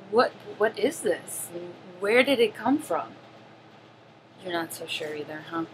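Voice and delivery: ordinary delivery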